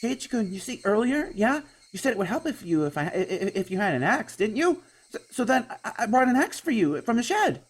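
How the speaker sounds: put-on high, nervous girl voice, stumbling; flustered young girl. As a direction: panicky girl over-explaining herself. Girl character explains nervously.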